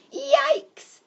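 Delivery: very high, loud yelp of alarm; startled shout. A narrator's reading. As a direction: yelp as if suddenly frightened. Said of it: "shouted Monty".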